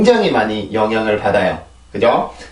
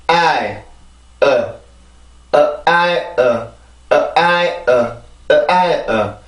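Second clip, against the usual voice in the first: small and big beats on 어 and 아이, 아이 stronger; vowel rhythm drill; much slower than usual. Shown as a demonstration. Teacher drilling the vowel rhythm of horizon, beat by beat. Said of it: "자, 읽어볼게요".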